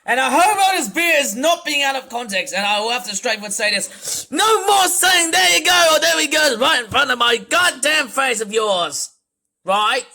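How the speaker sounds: angry rant. Real, not acted. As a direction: vent your rage at the character. Ranting at Otis Beer.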